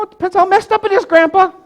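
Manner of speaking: put-on high, quick childlike voice; young grandson. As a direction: blurt the answer to grandpa. Boy answers grandpa's question.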